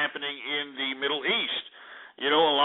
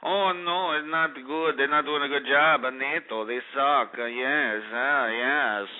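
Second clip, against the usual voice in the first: a mocking put-on French voice, only lightly done; mocking the French, their whining kept light; 3 semitones lower, slower than usual. Host mocks the French complaining about NATO, lightly in a put-on French voice. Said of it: "these French frogs over here talking about".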